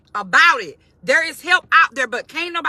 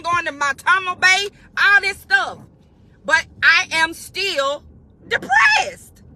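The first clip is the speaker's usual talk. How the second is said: raised, pleading voice building to a cry of depressed; pained confession; 6 semitones higher than usual. Acted. A person telling friends what's wrong, pleading, cry at the end. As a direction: voice someone finally admitting they're depressed. Person finally speaking out their pain.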